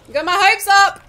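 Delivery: loud, high cry of dismay; frustrated yell. Real, not acted. Player yelling at the game in frustration. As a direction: shout it out, hopes dashed. Gamer yells at a lost drop.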